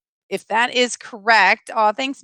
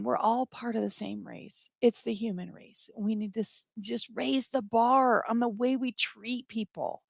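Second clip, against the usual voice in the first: choked up; 9 dB quieter than usual. Real, not acted. Streamer to viewers, choking up while pleading for kindness. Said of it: "I'm sorry I got so mo-, so emotional".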